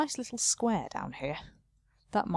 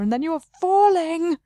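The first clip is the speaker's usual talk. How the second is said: dramatic; 9 semitones higher, 8 dB louder than usual. A narrator's reading. Dramatic narration of fall.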